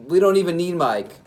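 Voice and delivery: high, loud gleeful boast; excited. Pretend.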